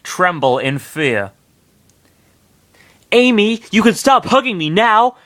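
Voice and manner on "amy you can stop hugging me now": higher, nervous politeness; scared smile. Acted. Sonic to clingy Amy, with a scared smile. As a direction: smile through the fear. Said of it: "with a scared smile".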